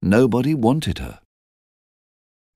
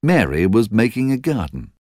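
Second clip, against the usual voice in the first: plain reading voice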